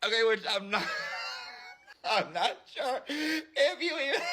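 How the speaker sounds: giggling through the words; cracking up mid-line; laughing. Real, not acted. Cracking up at her own bit, laughing.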